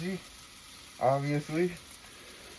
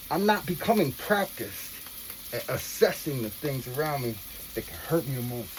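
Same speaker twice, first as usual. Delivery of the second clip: usual speaking voice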